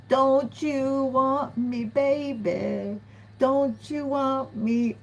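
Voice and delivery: high, slow, sing-song come-on; hammed-up seductive lover; mock seductive plea. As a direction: draw it out like a teasing tempter. Acting out an ex's seductive plea.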